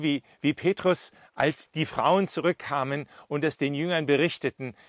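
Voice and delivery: ordinary talk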